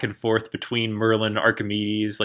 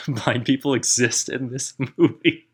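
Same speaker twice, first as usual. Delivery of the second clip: laughing echo. Real, not acted. Echoing partner while laughing.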